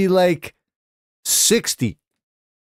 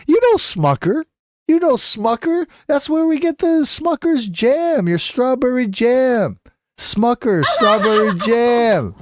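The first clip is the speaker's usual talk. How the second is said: flustered cover-up; 8 semitones higher than usual. Retold or imitated. Dad covering up the curse.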